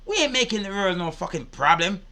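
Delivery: usual speaking voice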